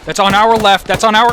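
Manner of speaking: urgent shout. Real, not acted. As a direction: yell it out to your team. Pointing out enemy under fire.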